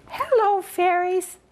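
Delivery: high, lilting sing-song hello; fairy bridge greeting. Shown as a demonstration. Showing children the greeting for the fairies, high and sing-song. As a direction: call it up high and sweet to the fairies. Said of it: "you must say".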